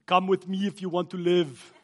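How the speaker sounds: movie quote in a put-on voice; put-on action-hero quote; Terminator. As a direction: do the famous action-hero line in an altered voice. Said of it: "Terminator two".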